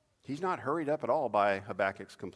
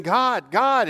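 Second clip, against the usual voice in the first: higher, loud desperate call; calling out to God; 11 semitones higher, 14 dB louder than usual. Acted. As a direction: cry his name upward, pleading.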